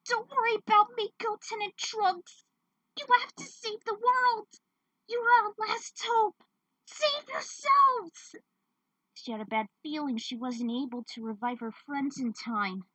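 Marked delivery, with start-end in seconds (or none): 0.1-8.4 much higher, slow desperate plea; pleading captive woman. Acted. Captive woman urging Goten and Trunks, high slow plea. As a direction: beg them to save the world.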